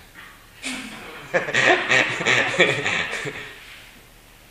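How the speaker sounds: a loud burst of real laughter; big amused laugh. Real, not acted. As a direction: laugh out loud at your own joke. Laughs at the magician joke.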